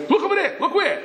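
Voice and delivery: high-pitched sudden alert; urgent diversion. Retold or imitated. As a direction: distract them with a sudden cry. Yossel creates a sudden diversion.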